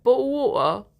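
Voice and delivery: Cockney with dropped T's; put-on Cockney, glottal T's; Cockney accent demo. Shown as a demonstration. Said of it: "that's like a Cockney accent".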